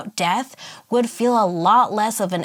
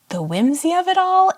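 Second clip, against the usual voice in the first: delighted; 9 semitones higher, 4 dB louder than usual. Real, not acted. Gushing about the inn.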